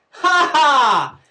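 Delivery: high, loud taunting ha ha; mocking ha ha. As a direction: jeer like the kids did. Mocking laugh at himself.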